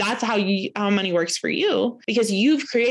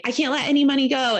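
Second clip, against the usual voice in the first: mock clingy panic; 7 semitones higher, 3 dB louder, faster than usual. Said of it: "sometimes you're like".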